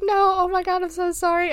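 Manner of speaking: high, flustered sorry, voicing the girl in the retelling; a retold rush of guilt, pitched high; imitating a frantic apology. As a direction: pitch jumps way up for a panicked mock apology. She apologises as he starts crying.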